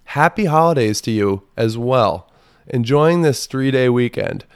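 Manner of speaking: mock cheerful text read aloud; mock sweet reply. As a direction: lay on fake sweetness. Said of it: "I said".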